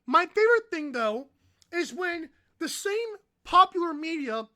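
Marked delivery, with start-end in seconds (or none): none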